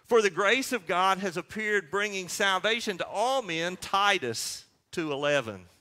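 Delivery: ordinary talk, nothing marked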